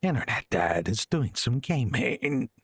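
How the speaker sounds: slow gruff Snake impression; drawn-out rough Snake growl. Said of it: "My snake impression's normally a bit better".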